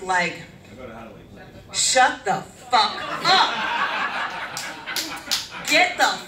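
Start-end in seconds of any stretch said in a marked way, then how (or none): 1.7-6.2 mock parent rant. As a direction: blow up at the kids, half laughing. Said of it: "things you wanna say to your kids".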